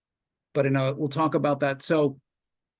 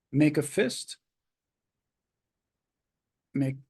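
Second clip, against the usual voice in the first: calm, measured relaxation guide; walk them through tensing and releasing, unhurried; guided relaxation. Acted. Leads progressive muscle relaxation.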